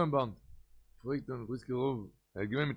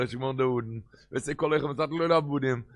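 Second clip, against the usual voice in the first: ordinary delivery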